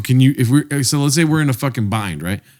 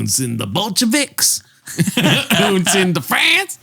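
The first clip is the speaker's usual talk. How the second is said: put-on slow, drawn-out German-Southern accent, higher; 7 semitones higher, slower than usual. Keeping up the mixed-accent bit for a friend, slow and higher. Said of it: "mix a German and Southern accent".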